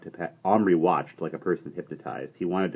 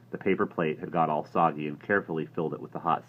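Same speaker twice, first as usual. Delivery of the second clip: plain reading voice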